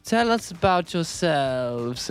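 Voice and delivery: high, slow, drawn-out come-on. Retold or imitated. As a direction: imitate tipsy girls leaning in. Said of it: "leaning all over us".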